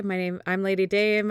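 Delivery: ordinary talk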